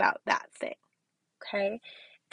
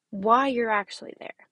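ordinary talk, nothing marked

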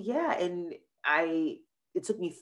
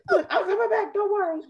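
much higher, louder, laughing through it; flustered laughing apology; 5 dB louder than usual. Retold or imitated. Retelling her giddy reply at the proposal, high and laughing. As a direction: giggle out a sorry-I'm-back.

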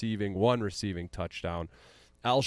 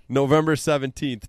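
ordinary talk, nothing marked

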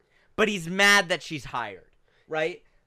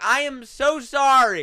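mock apologetic shout; 9 semitones higher, 8 dB louder, slower than usual. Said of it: "the manager's just shouting".

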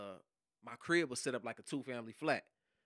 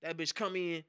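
usual speaking voice

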